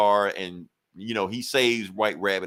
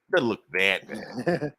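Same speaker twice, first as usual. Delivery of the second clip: drawn out, rising at the end; slow dubious echo; slow unconvinced repeat; slower than usual. Retold or imitated. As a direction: stretch it out, doubtful. Repeats the phrase, then slower.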